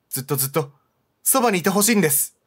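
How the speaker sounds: usual speaking voice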